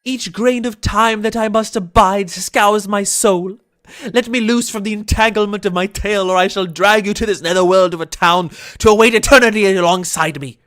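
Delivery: shouting. Acted. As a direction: roar it like a desperate ghost. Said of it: "I am shouting now".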